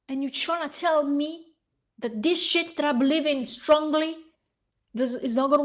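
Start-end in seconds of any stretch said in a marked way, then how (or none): none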